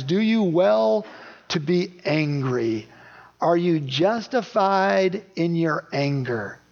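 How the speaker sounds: slow, deliberate question with a lift on you; slow rhetorical question. Shown as a demonstration. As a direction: lean on each word of God's question. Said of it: "That is a very interesting question".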